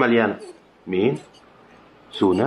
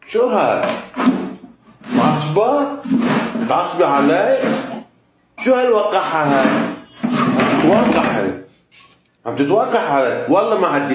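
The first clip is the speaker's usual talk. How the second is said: mock scolding; 6 semitones higher than usual. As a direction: scold her for being cheeky. Scolding a pet for rudeness.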